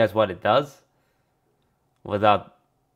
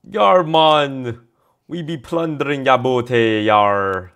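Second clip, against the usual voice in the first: higher, louder mock pirate-Jamaican troll accent; a hammed-up blend of troll and pirate accents; troll-pirate accent mix; 5 semitones higher, 8 dB louder, slower than usual. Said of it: "a way to combine both of them".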